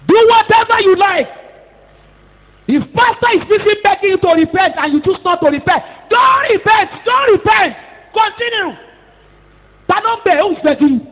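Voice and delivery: high, loud preaching shout; preacher shouting at an unrepentant congregation; preaching at full force. Real, not acted. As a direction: thunder the warning at the congregation. Preacher rebuking those who refuse repentance.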